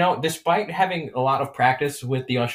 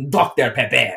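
loud hammed-up French accent; 6 dB louder than usual. Shown as a demonstration. Showing how to access the French accent, hammed-up. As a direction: roll it out like a cartoon Frenchman. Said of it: "the French accent I've only been able to access".

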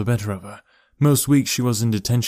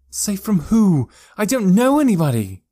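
loud, high distressed shout; upset outburst; 10 semitones higher, 5 dB louder than usual. A narrator's reading. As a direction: cry out, angry and scared. Elora angry and frightened.

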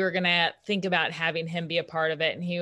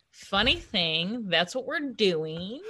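drawn-out, teasing reveal; slow and sly; 2 semitones higher, much slower than usual. Retold or imitated.

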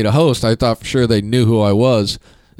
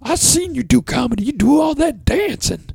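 imitating Kathy. Kathy again, same voice.

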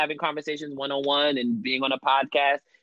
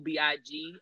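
ordinary delivery

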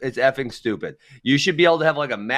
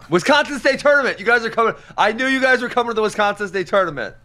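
much higher, teasing and excited; mock excitement; 10 semitones higher than usual. Real, not acted. Cohost teases about the state tournament, high and gleeful. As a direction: tease him with mock delight.

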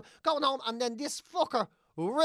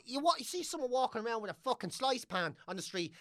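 normal talking voice

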